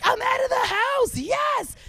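high-pitched acted cheer; triumphant little squeal of relief; gleeful celebration. Acts out her own celebration.

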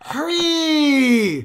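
very high, loud stretched wail; long pleading dog howl; whining dog. Acted. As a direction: beg impatiently in a hammed-up whine. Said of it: "like, whine".

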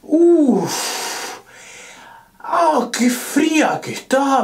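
high yelp at cold water; cold shock. Real, not acted. Cold water hits him.

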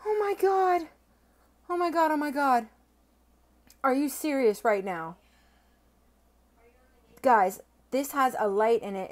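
can't contain the thrill; overwhelmed excitement. Real, not acted. Reacting to a glowing coin box.

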